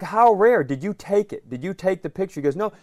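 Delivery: usual speaking voice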